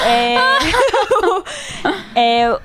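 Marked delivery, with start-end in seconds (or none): 0.0-2.1 a high burst of laughing through tears; cry-laugh burst; laughing sob. Real, not acted. Crying over mascara, laughing.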